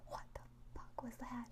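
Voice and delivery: hushed startled whisper. Retold or imitated. Startled whisper on waking at camp. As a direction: breathe it out, shocked awake. Startled awake, reacting in head.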